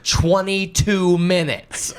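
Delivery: high, slow outraged complaint; proper Karen. Retold or imitated. Karen complaining at the taco cashier, high and slow. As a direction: play the Karen, stretch it out. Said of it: "I was just like".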